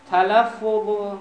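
one word stretched out at half speed; slow, drawn-out dictation. Shown as a demonstration. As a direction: draw the word out so students can write it. Dictating next word to students.

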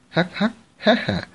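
high, crazed cackling laugh; maniacal laughter. A narrator's reading. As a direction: cackle like a madman. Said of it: "cười như điên".

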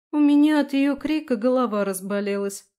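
put-upon complaint; complaining. A narrator's reading. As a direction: moan about your headache. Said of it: "пожаловалась Аби".

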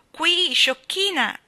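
high, loud, drawn-out call; slow sing-out from across the room. Acted. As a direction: call to get her attention. Unseen voice calls her to look.

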